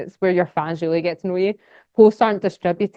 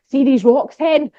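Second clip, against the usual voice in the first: higher, louder excited call with a Scottish hen; presenter to viewers, excited and folksy; enthusiastic Scottish hook; 5 semitones higher, 5 dB louder than usual. Shown as a demonstration. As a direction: grab the viewer with a lively Scottish opener. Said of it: "and I'm like".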